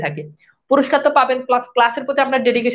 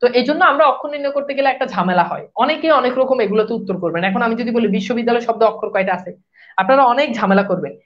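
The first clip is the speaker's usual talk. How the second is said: normal talking voice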